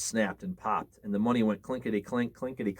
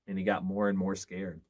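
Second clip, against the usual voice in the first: ordinary delivery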